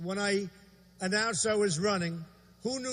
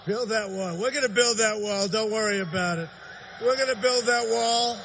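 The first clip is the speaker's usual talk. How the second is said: loud, building rally chant; rally speech; 2 semitones higher, 5 dB louder than usual. Real, not acted. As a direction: whip up the crowd, call and response. Rally call and response.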